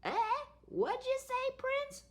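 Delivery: put-on high croaky old witch; croaking witch. A narrator's reading. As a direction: croak it like a deaf old crone. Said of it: "croaked the witch".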